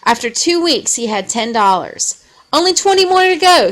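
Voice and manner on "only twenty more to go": hopeful Henry. A narrator's reading. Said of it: "said Henry".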